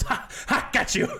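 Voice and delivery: imitated high, gleeful laugh; mock triumphant cackle into words; gloating prankster. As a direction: act out a giggling gotcha. Acting Michael gloating over his prank.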